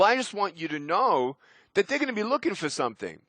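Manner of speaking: normal talking voice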